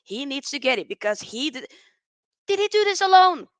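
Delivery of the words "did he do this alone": high loud exasperated question; exasperated outburst. Real, not acted. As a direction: demand it, fed up. Ranting about Messi.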